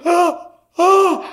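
high, sharp gasps for air; panicked gasps. Acted. Man covering himself before an intruder, acted panicked gasps. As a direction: act out breathless fright in short high bursts. Reenacting his frozen panic.

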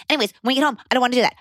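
high, rapid chipmunk chatter; annoying fast kid voice. Retold or imitated. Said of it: "this is how she was talking".

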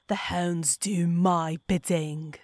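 possessing spirit gloating over the hounds; spirit's scream. A narrator's reading. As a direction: proclaim it slowly, loud. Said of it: "Martinos screamed".

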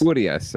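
"Urias" with a high opening U, then falling; stress on U. Shown as a demonstration. Co-host confirms how to say the name, stress on U. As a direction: land hard on the OO of "Urias". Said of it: "I think the accent is on the U".

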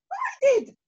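very high, tiny squeak. Acted. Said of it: "squeaked the voice".

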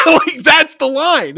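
high, loud burst through laughter; real laughter. Real, not acted. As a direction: laugh out the words excitedly. Bursts out laughing at own line.

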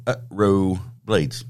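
UTRO split as "uh... roo", then Blades; slow, separated "uh-roo" for Utro; careful pronunciation. Shown as a demonstration. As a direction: say Utro slowly as two pieces, "uh, roo". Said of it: "it says on his page it's pronounced".

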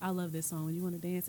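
soft, low ask close to the ear; whispered in ear. Shown as a demonstration. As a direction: murmur it right into her ear. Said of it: "In my ear".

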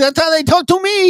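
very high, squeaky mock voice; put-on helium squeal; Mike Tyson on helium. Comic echoing his co-host as Tyson on helium. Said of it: "you sound like, uh, Mike Tyson on helium".

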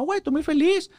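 high sarcastic imitation of a northern woman; mock northern woman. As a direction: play the woman insisting she's happy. Mimicking northern woman, ironic denial.

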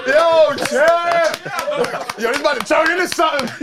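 mock alarm. Man demonstrating his safe word to friends, mock alarm. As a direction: call out a safe word in pretend urgency.

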